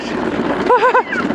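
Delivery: high delighted laughing; real laughter. Real, not acted. Laughing during the fast ride.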